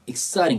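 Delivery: exciting with a quick tapped T, like R; linked, flapped T. Shown as a demonstration. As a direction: say "exciting" as "ek-SAI-ring". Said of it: "이렇게 나올 때가 많고". (exciting의 T를 '링'처럼 굴려서)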